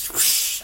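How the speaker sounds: airy rush of noise; imitated whoosh. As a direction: make a quick whooshing jump sound. Imitating the jump into water.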